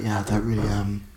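low, soft, hesitant undertone; hushed, halting mumble; imitated furtive whisper. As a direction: mimic someone keeping it down. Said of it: "he was trying to whisper it".

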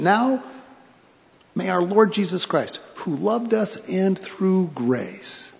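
"Now" lifted high, "who loved us and through grace" drawn out very slowly; emphatic slow reread. Shown as a demonstration. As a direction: reread the blessing, slowing to a crawl on "loved". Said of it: "Do you hear the prescription?".

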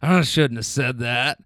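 lightly voiced old smoker chuckling; smoker's laugh. Driver imitating an old rider joking about bourbon, in a lightly voiced smoker's chuckle. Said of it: "laughs with that smoker's cough".

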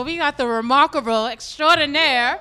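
loud, slow announcer build-up; hype intro. Pretend. Cohost introducing host, loud slow hype announcement. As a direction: announce him like a star. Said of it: "can I introduce you".

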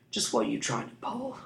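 rough scoundrel's voice, menacing; harsh thief. A narrator's reading. Thief menacing the boy who hit him, harsh. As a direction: growl it like a street thug. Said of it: "His voice was harsh".

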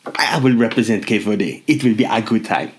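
lightly put-on Welsh accent, steady and formal; a Cape Verde athlete played as a mild Welsh accent. Cape Verde snowboarder announces himself in a light Welsh accent. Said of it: "the first accent was Welsh accent".

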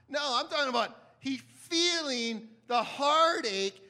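ordinary delivery

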